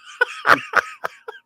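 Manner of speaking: high, loud burst of laughter; high squealing laugh. Real, not acted. Laughing again at the joke.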